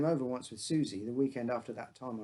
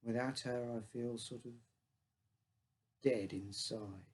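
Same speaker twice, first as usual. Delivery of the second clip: sad; 3 semitones lower, 5 dB quieter than usual. A narrator's reading. Grieving father confides.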